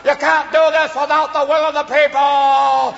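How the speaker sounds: high, loud, impassioned film-hero protest; Jimmy Stewart. Acted. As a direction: plead to the president, drawing out the end. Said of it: "It's Jimmy Stewart going".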